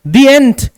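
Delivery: "The end" with "the" said as दी before the vowel. Shown as a demonstration. Teacher demonstrating दी before a vowel. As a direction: say "The end" with a long दी. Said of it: "तो दी हो गया".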